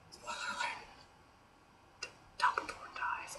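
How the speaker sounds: a hushed whisper, like a secret; whispered spoiler. Acted. Vlogger to viewers, whispering the spoiler.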